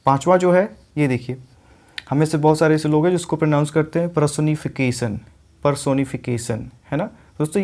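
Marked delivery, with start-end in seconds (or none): 4.1-6.7 पर्सोनिफिकेशन as per-so-ni-fi-KAY-sun; long o and s ending in पर्सोनिफिकेशन; common mispronunciation. Retold or imitated. Teacher quotes what many people say, पर्सोनिफिकेशन as per-so-ni-fi-kay-sun. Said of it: "ये पर्सोनिफिकेशन नहीं होता है".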